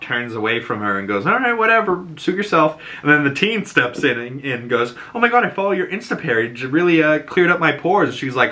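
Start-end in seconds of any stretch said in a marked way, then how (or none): 1.3-2.8 high, airy brush-off; dismissive. Retold or imitated. Agatha turns from Jen, airy and dismissive. 5.1-8.1 high, fast gushing; gushing fan. Retold or imitated. Teen gushes to Jen about her page.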